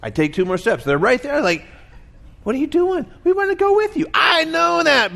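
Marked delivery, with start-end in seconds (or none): 2.4-4.1 pleading dogs voice. Retold or imitated. Pets begging their owner to come along, high whiny shout. 4.1-5.1 exasperated reply. Retold or imitated. Owner answering the begging dogs.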